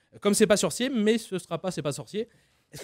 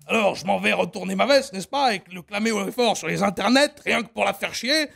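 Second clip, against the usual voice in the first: put-on loud, pompous old politician; a loud, hammed-up Le Pen; Le Pen impression; 3 semitones higher, 4 dB louder than usual. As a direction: declaim grandly with n'est-ce pas. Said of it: "Jean-Marie Le Pen".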